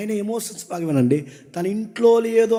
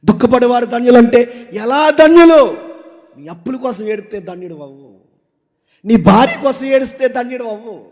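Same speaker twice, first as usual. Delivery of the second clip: preaching; 5 semitones higher, 9 dB louder than usual. Acted.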